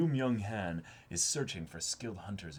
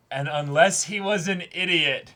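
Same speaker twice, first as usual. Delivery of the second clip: higher and much louder, building to a yell; excited shout; 12 semitones higher, 16 dB louder, slower than usual. Real, not acted. Reacting to the episode, rising into an excited yell. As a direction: shout it as the plot clicks. Excited yelling reading the plot.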